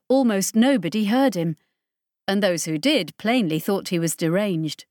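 ordinary delivery

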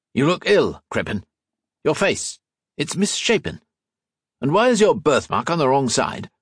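high, probing questions; suspicious. A narrator's reading. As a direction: grow suspicious as you speak.

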